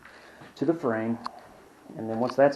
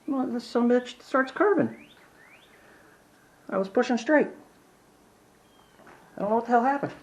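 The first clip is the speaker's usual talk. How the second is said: high, exasperated rant at the saw; angry; 12 semitones higher than usual. Real, not acted. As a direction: vent real frustration, voice pitched way up. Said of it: "I'm so fucking pissed".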